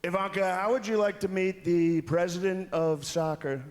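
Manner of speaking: high, slow mock Trump voice; Trump impression. Comic imitating Trump introducing soccer's president to Ivanka, slow. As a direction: drawl it like Trump to his daughter. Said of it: "And Trump goes".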